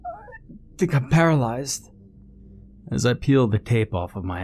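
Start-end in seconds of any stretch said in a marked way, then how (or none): 0.1-1.9 high, slow, strained moan; pained groaning.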